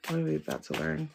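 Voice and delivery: soft, drawn-in wondering to herself; hushed question. Real, not acted. Reader awaiting the next card, hushed.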